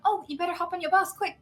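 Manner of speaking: fake cheerful hurry. Hurrying him onto his bus.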